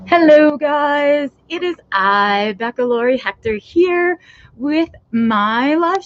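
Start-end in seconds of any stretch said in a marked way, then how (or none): none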